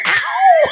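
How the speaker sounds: a very high, loud fake ow; mock pained yelp. Imitating the girl hit by the door, high laughing yelp. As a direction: squeal it like it hurt, laughing. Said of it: "She's like".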